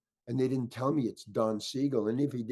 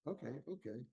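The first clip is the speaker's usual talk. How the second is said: much softer, backing-off reply; quiet placating; 15 dB quieter than usual. Retold or imitated. Director answering worked-up actor, quietly placating. Director backs off after outburst.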